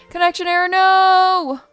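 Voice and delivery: high, loud wail at a game glitch; dismayed cry. Real, not acted. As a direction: cry out in protest, drawing out the no. Game drops connection, streamer cries out.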